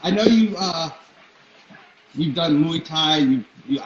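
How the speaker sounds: usual speaking voice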